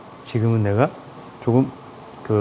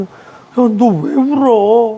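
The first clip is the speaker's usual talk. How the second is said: high, slow drawn-out concerned question; high pitched slow mock concern; friend asking why you cry; 9 semitones higher, 5 dB louder, much slower than usual. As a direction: stretch it out, asking a crying friend. Acting a friend asking why crying.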